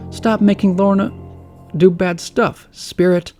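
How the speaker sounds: angry shout. Acted. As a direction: yell it angrily. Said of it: "shouts angrily at Wirt".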